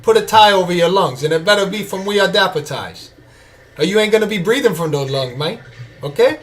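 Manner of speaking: hammed-up Cuban gangster accent; amateur Cuban-accented tough guy impression; Tony Montana. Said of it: "that was my best Tony Montana accent".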